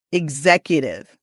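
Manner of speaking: "Executive" opening ig-ZEK, voiced gz. Shown as a demonstration. Coach introducing an interesting word, X as GZ. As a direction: say the x of "Executive" as g plus z. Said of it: "G sound and the Z sound".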